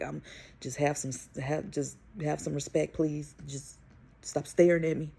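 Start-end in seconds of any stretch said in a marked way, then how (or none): none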